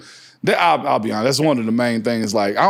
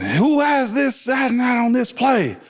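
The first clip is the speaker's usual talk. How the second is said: coach shouting; 7 semitones higher than usual. Retold or imitated. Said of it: "Todd Haley was asking".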